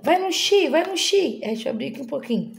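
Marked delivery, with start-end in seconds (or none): 0.0-1.4 high, loud urging of the marble; excited cheering mom. Pretend. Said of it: "a mamãe fala".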